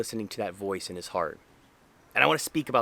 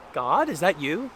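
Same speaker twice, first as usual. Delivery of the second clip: higher, questioning imitation of a film line; movie-line question; 6 semitones higher, 4 dB louder than usual. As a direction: ask upward as if quoting a comedy. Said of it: "Remember that line".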